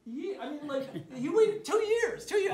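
high, loud, drawn-out disbelief. Retold or imitated. Son stunned that dad kept the joke going: incredulous. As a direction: stretch out the years, incredulous.

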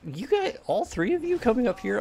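high-pitched disbelief at the sight; startled, voice jumping up high; surprised disbelief. Real, not acted. Three enemies invade at once.

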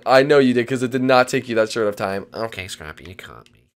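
ordinary delivery

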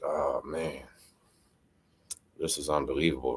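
low, soft disbelief under the jacket; stunned whisper-like aside. Retold or imitated. Hiding under his jacket, quietly stunned. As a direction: mutter it to yourself, shocked. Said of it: "I'm over there like".

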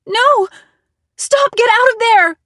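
high, loud desperate screaming; hoarse scream. A narrator's reading. Narrator screams at the intruder near Harry's room, desperate. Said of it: "I screamed in a hoarse voice".

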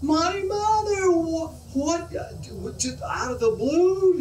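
high, slow, near-crying wail; high, drawn-out cry of a man beside himself; distraught man. Acted. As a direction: wail it out in horrified disbelief. Man hears his mother died.

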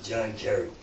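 haunted night-time murmur; mock muttering. Comic pacing at night, softly muttering the name. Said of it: "I walked around like this".

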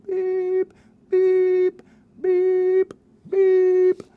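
very high beep noises; robot beeps. Acted. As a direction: beep like a machine. Sound effects.

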